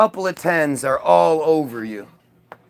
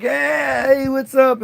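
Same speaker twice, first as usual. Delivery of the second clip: cocky greeting; 8 semitones higher, much slower than usual. Retold or imitated. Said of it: "walk up to the front desk like".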